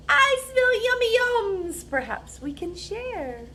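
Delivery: high sniffing badger voice. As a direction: squeak it up high like a greedy little badger. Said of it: "A badger scuttles by".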